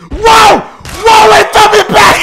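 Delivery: loud, high whoops of real delight; thrilled gamer hollering; excited shout. Real, not acted. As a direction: yell it out like the ride just dropped. Hyped reaction at game start.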